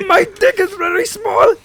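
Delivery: high, loud hammed-up Irish voice; mock Irish accent. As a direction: keep the over-the-top Irish lilt.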